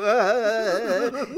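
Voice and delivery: loud, high, drawn-out "ah" sounds; goofy repeated cartoon cries; imitated cartoon villain noise. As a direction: mimic silly bad guys popping up. Imitates bad guys' cartoon noise.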